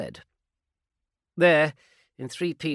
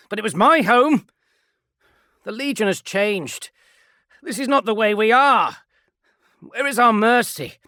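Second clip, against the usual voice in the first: high, loud impassioned plea; rally your brothers to mercy; impassioned marine; 12 semitones higher, 12 dB louder than usual. Acted. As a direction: Kelefon pleads for mercy, loud and passionate. Kelefon pleads with squad.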